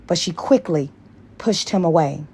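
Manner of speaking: ordinary talk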